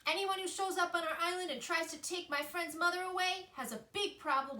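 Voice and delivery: high, loud feisty squirrel yell. A narrator's reading. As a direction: warn the intruder fiercely.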